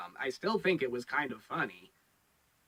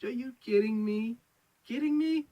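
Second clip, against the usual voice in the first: higher and higher, you can't be serious; mock disbelief; 9 semitones higher than usual. Reviewer mocking the show's silly excuse, rising disbelief. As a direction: scoff in exaggerated disbelief. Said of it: "I'm like".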